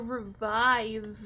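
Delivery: "revive" drawn out slowly with a long i; long-i emphasis. Shown as a demonstration. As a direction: stretch the VIVE in "revive". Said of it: "like the name Viv".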